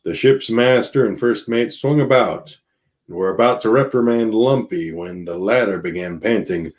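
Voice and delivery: ordinary delivery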